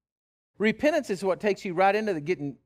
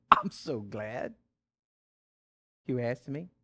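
slow, low, gentle welcome; tender Jesus; 5 semitones lower, slower than usual. Pretend. Preacher voicing Jesus glad that you asked, slow and tender.

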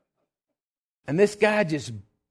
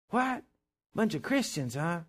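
mocking sneer; 5 semitones higher, 3 dB quieter than usual. Drunk mocks the Christians.

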